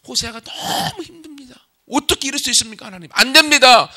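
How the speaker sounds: high, anguished plea; pleading Hosea. Acted. (하나님께 괴롭게 호소하는 높은 목소리)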